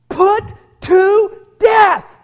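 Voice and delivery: "Put to death" shouted word by word; slow, loud, high punch on each word of "Put to death"; emphatic proclamation. Real, not acted. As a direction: hammer out "Put... to... death" with pauses. Preacher proclaims scripture command.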